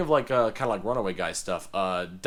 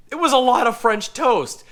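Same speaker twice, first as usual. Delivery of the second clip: exasperated; 12 semitones higher, 8 dB louder than usual. Real, not acted. Exclaiming about the amount of food.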